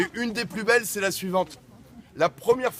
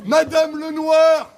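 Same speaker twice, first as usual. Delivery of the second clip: calling out; 8 semitones higher, 8 dB louder, slower than usual. Real, not acted. Crier calling a name.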